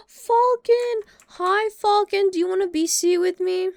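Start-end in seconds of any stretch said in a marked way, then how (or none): 0.1-2.3 high, loud, drawn-out call of the name; calling a friend over with delight; excited greeting. Real, not acted. Greeting friend Falcon on arrival.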